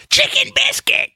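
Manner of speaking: put-on very high old-man voice. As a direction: family joke copying grandpa's answer. Family now imitating grandpa.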